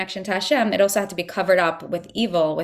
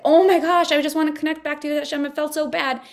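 eager plea to reconnect; 6 semitones higher than usual. Retold or imitated. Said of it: "see the difference and say".

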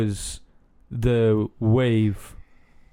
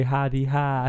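put-on Cardiff accent; 2 semitones higher, slower than usual. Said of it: "here we say".

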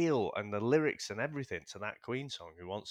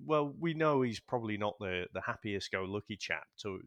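ordinary delivery

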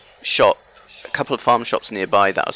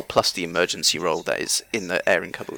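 ordinary talk, nothing marked

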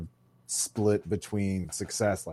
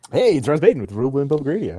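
booming fast radio announcer intro; 6 semitones higher, 10 dB louder, much faster than usual. Pretend. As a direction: boom it out like a radio host. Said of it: "I have to do the announcer voice".

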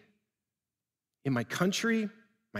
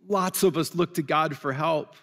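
ordinary delivery

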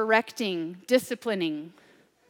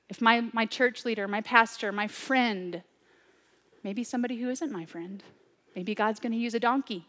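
ordinary talk

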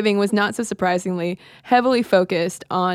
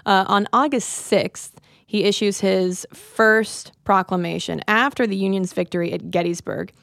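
ordinary talk, nothing marked

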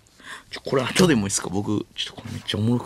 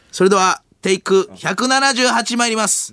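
high, raised announcing voice; film crew caller; 11 semitones higher, 4 dB louder than usual. Acted. Staffer announcing yet another take, high crew call. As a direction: call the take across the set.

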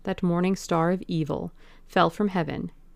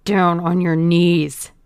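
a slow, much louder menacing command; growled; 9 dB louder, slower than usual. Acted. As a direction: drag out each word as a threat. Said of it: "growled the man".

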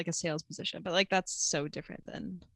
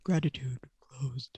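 low, slow whispered take; deep whisper; 6 semitones lower, slower than usual. Shown as a demonstration. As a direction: breathe it out low and quiet. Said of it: "Can you do it quieter?".

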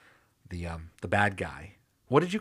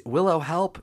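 high, loud mock scream calling for Willow; mock scream for help; 8 semitones higher, 4 dB louder than usual. Retelling Dawn's scream, a mock shriek for help. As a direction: squeal it like a scared little sister. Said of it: "Dawn's piercing screams".

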